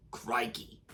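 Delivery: put-on lightly done Aussie accent; Steve Irwin Australian accent. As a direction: toss off a croc hunter's Crikey. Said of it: "my Steve Irwin Australian accent".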